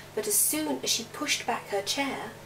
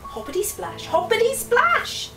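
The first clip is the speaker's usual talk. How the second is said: building hops; 11 semitones higher, 4 dB louder than usual. A narrator's reading. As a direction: build from a hush to a loud splash. Frog hopping up the stairs.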